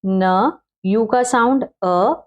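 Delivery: sounds separated, very slow; धीरे-धीरे ध्वनियाँ अलग करना; slow sound breakdown. Shown as a demonstration. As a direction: break the word into sounds slowly. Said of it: "न का sound क्या है?".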